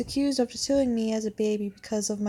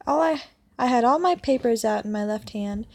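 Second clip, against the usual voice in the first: normal talking voice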